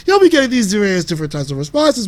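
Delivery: usual speaking voice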